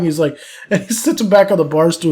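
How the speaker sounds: ordinary talk, nothing marked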